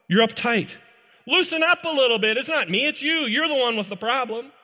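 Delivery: mock-defiant, dismissive voice; defiant excuse-maker. Preacher mocks someone excusing their anger, smug and defiant. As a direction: throw the blame back, smug.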